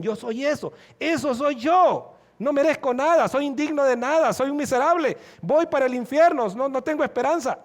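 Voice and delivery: high, impassioned confession of unworthiness; despairing sinner. Acted. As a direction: preach it as a condemned sinner's cry. Acting a convicted sinner's despairing confession.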